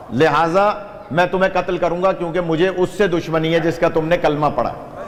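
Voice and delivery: ordinary talk, nothing marked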